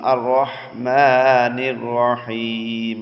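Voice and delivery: "রহমানির" with the long vowel held for one alif, chanted slowly; one alif elongation. Shown as a demonstration. Teacher showing students a one alif stretch in chant. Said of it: "এক আলিফ টান আছে".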